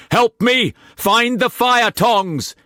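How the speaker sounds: high, slow, loud yell; yelling over the storm. A narrator's reading. Cullum yelling to Tess above the storm, loud. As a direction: bellow for help. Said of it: "he yelled above the storm".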